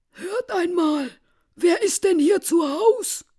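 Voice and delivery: high, loud visitor's call. Acted. Strangers asking who is home, high loud call. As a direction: call into the house, pitched right up.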